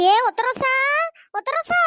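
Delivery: high, rising spooky call of a name; eerie ghost call. Acted. An unseen ghost calls the girl's name eerily. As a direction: lure her name out of the dark. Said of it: "வேற குரல்ல கூப்பிடுது".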